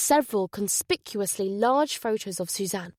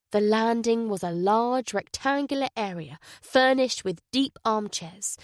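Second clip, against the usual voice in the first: ordinary delivery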